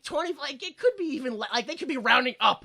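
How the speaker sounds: ordinary talk, nothing marked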